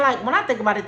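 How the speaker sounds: ordinary talk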